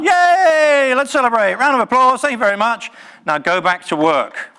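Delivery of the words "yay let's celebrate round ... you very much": mock cheer. Mock celebration ending talk.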